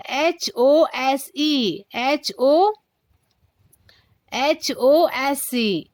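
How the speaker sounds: एच ओ एस ई spelt slowly, letter by letter; slow spelling. Shown as a demonstration. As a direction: spell it out with each letter separate. Teacher spells a word out. (एक-एक अक्षर धीरे-धीरे बोलें)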